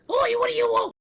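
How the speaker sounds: very high, loud goblin screech. Acted. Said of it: "you hear a screeching goblin voice".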